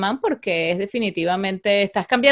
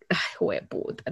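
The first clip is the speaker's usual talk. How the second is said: muttered curse; 5 semitones lower, 14 dB quieter than usual. Real, not acted. Can't find the word.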